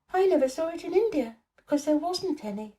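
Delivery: put-on high, curious little girl; a bright girl teasing Colin gently; Mary, girl's voice. A narrator's reading. Mary asks Colin what he's thinking, high girl's voice.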